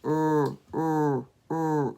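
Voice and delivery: long rolled-lip R; growled R sound. Shown as a demonstration. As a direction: poke your lips out and hold the R. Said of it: "just make the beginning sound".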